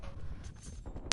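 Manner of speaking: long heavy unvoiced sigh; dramatic sigh. Real, not acted. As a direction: let out a big tired sigh. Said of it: "That's just a big sigh for me".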